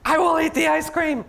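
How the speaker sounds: high, emphatic declaration; triumphant elephant resolve. Acted. Elephant deciding Piggy need never know, triumphant. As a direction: announce it boldly, pitched well up.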